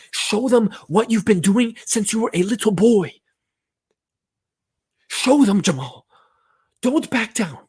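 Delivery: high, loud rallying cry; fired-up pep talk. Acted. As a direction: urge the player on at a shout.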